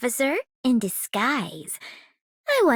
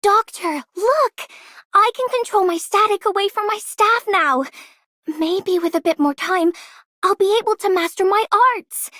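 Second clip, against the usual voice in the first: eager, bright and louder, calling for attention; proud kid showing off a new trick; thrilled to show the Doctor her progress, louder; excited; 5 semitones higher, 4 dB louder than usual. Acted.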